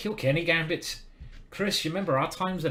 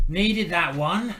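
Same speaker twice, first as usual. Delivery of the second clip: drawn-out, higher, louder relief; relieved win; 5 semitones higher, 8 dB louder, much slower than usual. Real, not acted. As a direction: let out the tension of a hard-won game. Relief after scraping a win.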